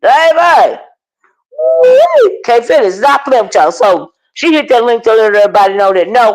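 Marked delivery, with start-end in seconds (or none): 0.0-0.8 put-on exclaimed baby. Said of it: "I said". 1.6-2.4 high loud woo; excited whoop. Real, not acted. Vlogger whooping at the online drama.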